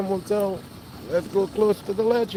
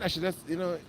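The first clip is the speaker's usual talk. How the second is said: ordinary talk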